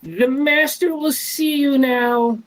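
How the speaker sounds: high, slow kobold servant voice; squeaky, slow little servant with a bow; creepy butler. Acted. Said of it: "And it goes".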